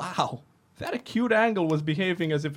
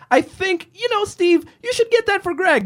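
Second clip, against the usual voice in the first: high put-on voice; 12 semitones higher, 4 dB louder than usual. S1 voices fake suggestion.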